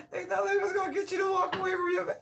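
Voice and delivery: words breaking up into high laughter; can't get through the line for laughing. Real, not acted. Laughing at haters while reading cards. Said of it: "I'm crying laughing".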